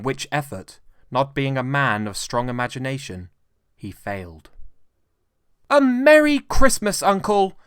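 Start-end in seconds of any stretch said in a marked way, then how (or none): 5.7-7.6 high, loud, joyful greeting; cheerful cry. A narrator's reading. Nephew calling a merry greeting to Scrooge. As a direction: burst in with a bright cheer. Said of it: "cried a cheerful voice".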